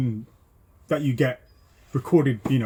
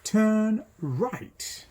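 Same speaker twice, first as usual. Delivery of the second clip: slow, lilting, higher recorded sat nav prompt; 6 semitones higher, much slower than usual. Retold or imitated. Instructor imitating the sat nav announcing the turn, slow and lilting. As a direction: draw out each word like a monotone navigation voice. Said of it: "sat nav".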